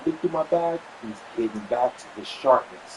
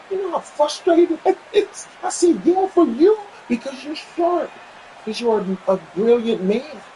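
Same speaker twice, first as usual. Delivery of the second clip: laughing high exclaim; 13 semitones higher, 6 dB louder than usual. Retold or imitated. Frustration shared, laughing high.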